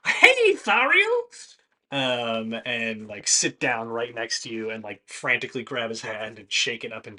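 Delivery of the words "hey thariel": very high, loud and slow hail; overjoyed construct calling out to an old friend; cheery robot greeting. Acted. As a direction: sing out a delighted hello across the bar. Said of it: "Ted will go".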